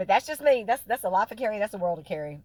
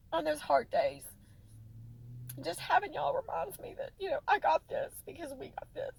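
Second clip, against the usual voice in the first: tearful, fighting back the crying; 9 semitones higher than usual. Real, not acted. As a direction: voice breaking high through tears. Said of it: "where I'm not crying".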